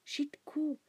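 urgent breathy hush; old man whispering in fear; frightened whisper. A narrator's reading. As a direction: hush them in terror.

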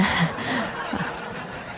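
short, soft breathy laugh; awkward laugh. Acted. Employee thanking a nosy boss, awkward soft laugh.

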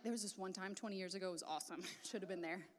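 low, soft throwaway voice; dropped-voice offhand brag; mock reminiscing. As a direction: play someone idly recalling old glory, low and quiet. Mock reminiscing about past glory.

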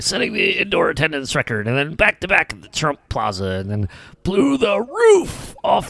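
high, chanting promo voiceover; Vince McMahon. Retold or imitated. Co-host recites the old voiceover like a song, hyped. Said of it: "like, his voiceovers for this".

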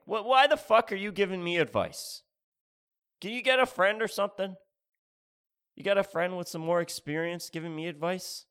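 a loud, fed-up younger self snapping back; exasperated outburst. Retold or imitated. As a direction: blurt it out, fed up with him. Exasperated retort to stranger.

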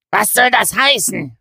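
loud, high indignant bellow; outraged roar. A narrator's reading. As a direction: roar at the insult. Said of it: "brüllte Kott empört".

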